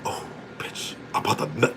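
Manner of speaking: whispered aside; hushed breathless whisper. Retold or imitated. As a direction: whisper it like a secret thrill.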